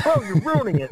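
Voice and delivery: high-pitched retold complaint; playfully exaggerated exasperation; mock mad. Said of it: "I, I was all mad".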